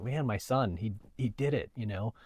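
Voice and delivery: soft, hushed quote of a moved father; retold near tears. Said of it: "my dad was almost in tears".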